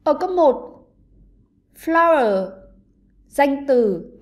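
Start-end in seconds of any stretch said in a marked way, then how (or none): 1.8-2.5 "flower" with stress on the first syllable; strong start to "flower", falling away on the second syllable; teacher's demo of "flower", beat on FLOW. Shown as a demonstration. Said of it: "Ba từ này sẽ có trọng âm rơi vào âm tiết thứ nhất".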